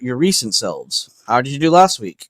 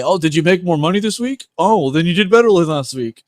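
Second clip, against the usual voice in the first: mock results-focused coach; 6 semitones higher, 5 dB louder than usual. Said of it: "I didn't say".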